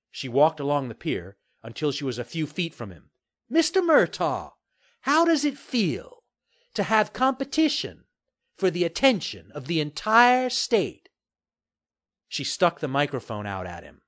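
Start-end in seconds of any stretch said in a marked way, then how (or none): 3.5-11.1 high-pitched, measured interviewer voice; interviewing reporter. A narrator's reading. Reporter putting the developer on the spot, on-air interviewing. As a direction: put the question in deliberate phrases, high.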